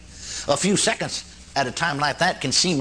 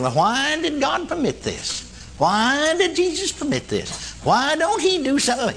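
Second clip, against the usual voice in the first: higher, slow pleading questions; questioning plea; 9 semitones higher, slower than usual. Retold or imitated. Preacher voices the believer's plea, slow and higher. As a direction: ask why, mildly pleading. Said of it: "we ask the question".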